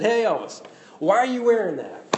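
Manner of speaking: high, loud call to Elvis; lightly voiced buddy calling out; imitating a friend. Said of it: "They said".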